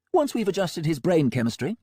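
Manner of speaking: breezy, untroubled villain doctor; Melmoth voice. A narrator's reading.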